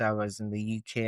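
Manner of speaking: usual speaking voice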